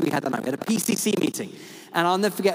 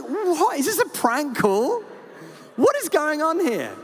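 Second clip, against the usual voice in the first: much higher, slow, disbelieving; retold disbelief; 10 semitones higher, slower than usual. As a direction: act out the shock of seeing who's calling. Retold shock at Archbishop's call.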